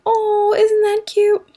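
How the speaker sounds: high squealy "isn't that cute"; cooing over something cute. Shown as a demonstration. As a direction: gush like seeing a puppy. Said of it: "our way of saying".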